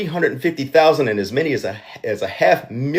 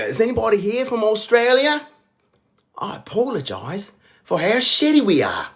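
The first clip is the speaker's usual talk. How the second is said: put-on Australian accent, pitched up; a jokey Aussie accent aimed at the crowd; mock Australian apology; 9 semitones higher than usual. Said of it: "Is that a good Australian accent?".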